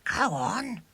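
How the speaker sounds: high voiced scornful push; scornful urging. A narrator's reading. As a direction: urge him on with scorn. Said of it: "urged William scornfully".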